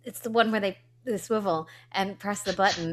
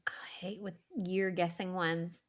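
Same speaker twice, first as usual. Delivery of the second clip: whispered aside; 2 semitones lower, 9 dB quieter than usual. Real, not acted. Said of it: "whispered aside".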